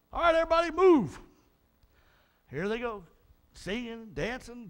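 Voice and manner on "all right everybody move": loud call to the crowd; rally them across; mock leader shout to move. Moses calling the people across.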